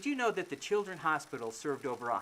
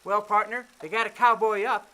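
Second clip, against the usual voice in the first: cowboy voice; 4 semitones higher, 6 dB louder than usual. Acted. Cowboy talk, louder.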